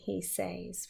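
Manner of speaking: usual speaking voice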